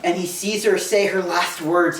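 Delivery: normal talking voice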